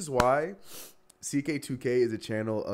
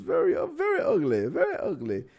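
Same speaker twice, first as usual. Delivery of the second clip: squeaky high. Real, not acted. Reacting to a bad game.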